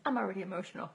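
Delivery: real teary laugh through the words; choked-up giggle; laughing emotional. Real, not acted. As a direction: laugh as you admit being moved. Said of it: "I'm gonna cry".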